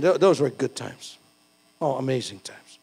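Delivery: ordinary delivery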